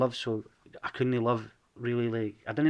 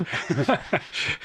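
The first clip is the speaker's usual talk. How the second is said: laugh; 7 semitones higher, 3 dB louder than usual. Real, not acted. Nervous laugh before approaching.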